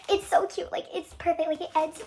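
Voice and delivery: laughing. Real, not acted. Gushing about the ending.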